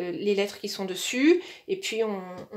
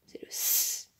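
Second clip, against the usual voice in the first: whisper; 8 dB quieter, slower than usual. Retold or imitated. Voicing the child's answer.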